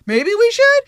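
put-on high little proposal; imitated tentative suggestion. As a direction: pipe up high, as if floating an idea. Quoting Molly's hesitant suggestion.

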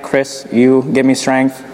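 ordinary delivery

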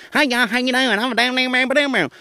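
high-pitched rapid Southern babble, words running together; exaggerated high Southern twang; thick Southern accent. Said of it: "there's several Southern accents".

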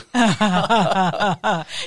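loud, high burst of laughter; real laugh. Real, not acted. Laughing with the host at the story's end.

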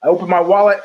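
ordinary talk, nothing marked

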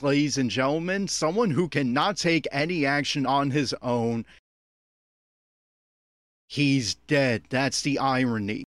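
ordinary talk

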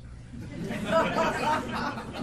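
high breathless laugh; airy laugh. Real, not acted. Laughs after quote.

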